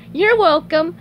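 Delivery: high, chirpy character voice; SpongeBob voice. Acted. Answering his beloved pet, high cartoon voice. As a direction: pitch it high and loud, cartoon-like. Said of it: "SpongeBob said to his beloved pet".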